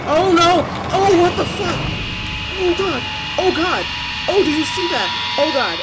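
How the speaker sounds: high, loud startled outburst; alarmed. Real, not acted. Player reacting to a horror game scare, alarmed. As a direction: cry out in alarm at a sudden scare.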